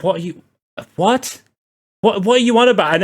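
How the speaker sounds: mock incredulous, pitch jumping up; imitating a group's incredulous what; baffled disbelief. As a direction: act baffled at a nonsense remark. Everyone baffled by his remark.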